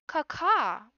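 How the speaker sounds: high, loud crow-like cawing; baby rabbit shouting. A narrator's reading. Little rabbit in a tunic alerting his sister, shouting. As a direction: shout a shrieked caw at full voice. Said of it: "shouting at the top of his voice".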